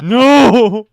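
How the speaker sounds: very loud, high drawn-out cry; mock anguished wail. Friend playing along with the tease, mock anguish. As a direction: wail it out in hammed-up despair.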